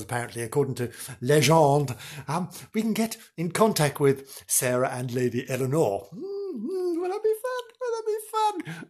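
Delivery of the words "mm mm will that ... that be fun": high squeaky mock eagerness; sarcastic high-pitched thrill; mock excitement. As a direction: squeal it like a giddy child.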